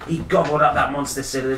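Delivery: ordinary delivery, nothing marked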